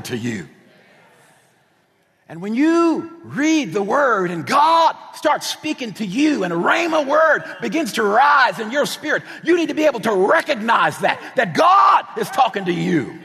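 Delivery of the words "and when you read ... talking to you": loud, high, building preacher's cry; preaching. Real, not acted. Preacher pressing the congregation, at full force. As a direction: drive it home to the congregation.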